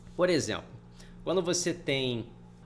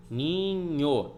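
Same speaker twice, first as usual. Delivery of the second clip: "Ninho" stretched into two syllables, ni-nho; drawn-out "Ninho" as ni... nho; syllable division. Shown as a demonstration. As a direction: say "Ninho" syllable by syllable, keeping nh together. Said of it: "Vai ficar na mesma sílaba".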